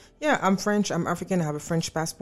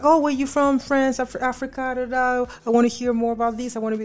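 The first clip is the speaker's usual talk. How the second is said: bright, higher eager questioning; mildly mimicking excited Americans; curious eager; 7 semitones higher than usual. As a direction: sound delighted and curious. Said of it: "people were just like".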